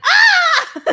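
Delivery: sudden high shriek, laughing through it; imitated panda shriek. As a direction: squeal like a startled red panda, half laughing. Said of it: "he's like".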